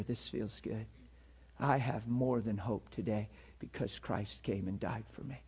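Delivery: soft, low, heartfelt prayer to the Father; sample thankful prayer. Shown as a demonstration. Preacher showing the congregation a simple prayer, soft and sincere. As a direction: pray it gently, like it's your own. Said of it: "The connection is".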